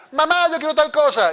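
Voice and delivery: high, loud mock child's demand. Child demanding something from mother, mock insistence. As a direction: pipe up like a kid insisting. Un hijo exigente, actuado.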